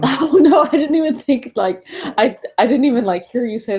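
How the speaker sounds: high, loud laughing protest. Real, not acted. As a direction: laugh it off embarrassed. Caught sitting up straight, laughing.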